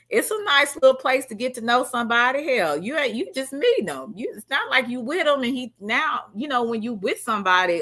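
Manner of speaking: normal talking voice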